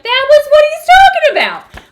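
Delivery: very high, loud burst of discovery; re-enacted astonished outcry; excited realization. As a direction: exclaim it like a sudden revelation. Acting out her sudden realization.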